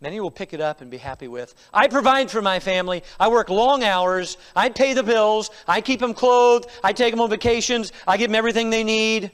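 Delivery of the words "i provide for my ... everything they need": loud, insistent list of boasts; self-justifying father. Acted. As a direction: bellow it like a defensive dad. Voices fathers boasting of providing.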